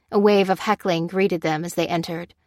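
usual speaking voice

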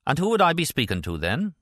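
put-on spy on the phone in a rusty Irish accent. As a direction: put on a rough Irish accent to fool her. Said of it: "retrieved Dante Pippin's rusty Irish accent".

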